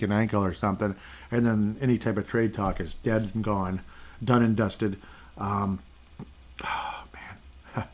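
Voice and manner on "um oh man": breathy whispered groan; whispered sigh. Real, not acted. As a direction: sigh it out under your breath. Sighing over bad news.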